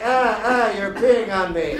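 a hammed-up horrified protest; whiny president. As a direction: play the president squirming and whining. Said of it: "And he's like".

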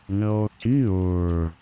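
normal talking voice

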